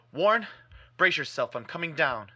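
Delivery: loud, resolute shout; shouted resolve. Acted. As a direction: call down with sudden resolve. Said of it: "I formed and shouted a resolution".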